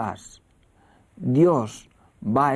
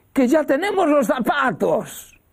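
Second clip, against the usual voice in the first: high, loud, overjoyed; joyful announcement; 13 semitones higher, 5 dB louder than usual. Retold or imitated. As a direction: burst out with the good news. Madre feliz anuncia los zapatos. (anuncio lleno de alegría, agudo y fuerte)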